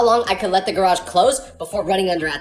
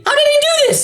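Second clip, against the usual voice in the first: much higher and louder, disbelieving; how is that even possible, pitched way up; incredulous outburst; 6 dB louder, faster than usual. Real, not acted. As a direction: blurt it out in total disbelief. Disbelief at friend answering in court.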